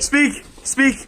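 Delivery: very high squeaky command; chipmunk-high dog command; helium voice. Real, not acted. Shoot all the helium and then bark.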